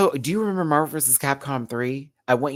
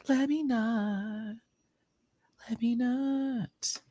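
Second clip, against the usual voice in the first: singsong self-restraint; 13 semitones higher, 4 dB quieter, slower than usual. Pretend. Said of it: "let me not do that today".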